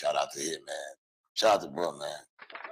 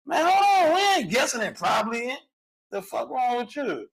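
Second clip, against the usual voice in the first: loud, much higher burst of disbelief; exasperated outburst; 6 dB louder than usual. Real, not acted. Cutting off a story full of guesses, loud exasperated outburst. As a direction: shout him down, fed up.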